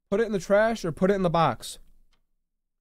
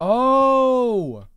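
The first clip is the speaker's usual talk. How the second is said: surprised oh; 7 semitones higher, 5 dB louder than usual. Real, not acted. Game offers an option.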